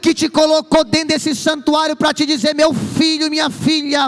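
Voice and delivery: loud, slow, building preaching; preaching at full force. Acted. Preacher telling the congregation God never lost control, full-force preaching. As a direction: pound each phrase home to the church. Climax of sermon.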